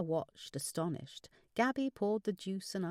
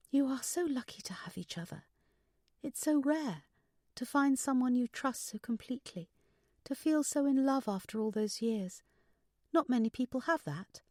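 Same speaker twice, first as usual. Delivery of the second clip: daughter's higher voice, full of longing; wistful daughter; 7 semitones higher than usual. Acted. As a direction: speak high as a wistful daughter.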